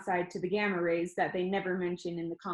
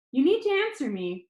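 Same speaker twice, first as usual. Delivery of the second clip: higher, firm demand; stern scold; 6 semitones higher, 4 dB louder than usual. Acted. Grandma demanding an answer from her grandson, stern. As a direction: make him answer now.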